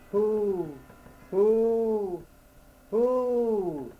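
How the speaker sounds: high, drawn-out owl hoot. A narrator's reading. Owl answering the lost man, high hooting.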